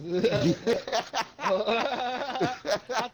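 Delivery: long, hearty burst of laughter; big laugh. Real, not acted. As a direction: crack up at a friend's joke. Cracks up at friend's joke.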